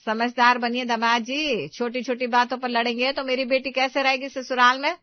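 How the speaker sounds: lecturing mother-in-law. Acted. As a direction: lay down the law to the son-in-law.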